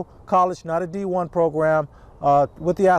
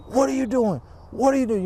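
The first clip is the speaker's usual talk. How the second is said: imitated angry coach; 8 semitones higher than usual. Livid coach to one kid across the gym, mock yelling. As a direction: play the livid coach scolding a player. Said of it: "he's yelling at this one kid".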